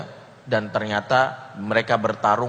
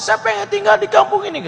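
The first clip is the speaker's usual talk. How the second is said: high falsetto woman saying no; refusing woman's voice; 7 dB louder than usual. Acted. Woman refusing to leave her town, high falsetto. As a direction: squeak the refusal like a pouty girl.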